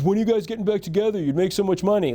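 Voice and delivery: high, fast mock of a clueless kid; dumb kid's question. Reporter mocking the kid who asked [name], high and fast. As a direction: ham up the naive young reporter.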